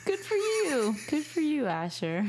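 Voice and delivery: high, laughing congratulations; laughing praise. Real, not acted. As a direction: cheer him on through giggles. Mom praises son, laughing.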